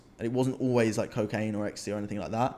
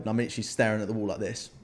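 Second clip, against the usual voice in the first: normal talking voice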